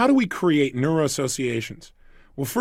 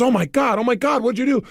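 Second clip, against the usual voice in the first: freaking out; 6 semitones higher than usual. Retold or imitated. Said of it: "She immediately freaks out".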